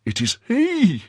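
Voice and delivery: sudden high cry of recognition; startled cry. A narrator's reading. Bonacieux recognizing his wife's abductor, startled cry. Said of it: "cried Bonacieux".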